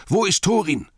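high, loud urgent call; Bilbo. A narrator's reading. Hobbit calling for Thorin, urgent high shout. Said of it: "rief Bilbo".

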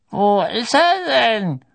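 high, thin, slow and loud; puzzled old official, thin high voice; thin old man's voice. A narrator's reading. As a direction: stretch it out high and reedy. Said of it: "sagte die dünne Stimme des Alten".

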